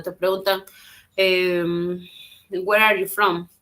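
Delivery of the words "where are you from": usual speaking voice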